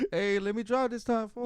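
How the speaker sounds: put-on playful Mexican-accented plea; Mexican guy. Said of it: "why I made that guy Mexican".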